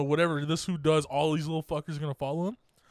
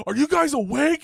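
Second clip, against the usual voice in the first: team leader calling out; 9 semitones higher, 5 dB louder than usual. Retold or imitated. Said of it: "So like".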